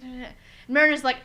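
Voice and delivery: normal talking voice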